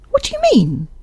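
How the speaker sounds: high-pitched, sharply demanding; sudden alarmed challenge in a high voice. A narrator's reading. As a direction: demand an explanation, pitched right up. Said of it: "she demanded sharply".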